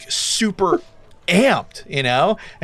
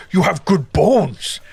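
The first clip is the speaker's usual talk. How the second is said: hog man. Acted. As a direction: play a hog man sizing up a recruit. Game master voices the hog man.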